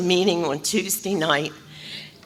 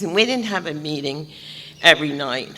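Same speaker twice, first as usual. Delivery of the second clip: ordinary talk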